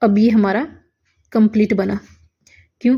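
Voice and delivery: usual speaking voice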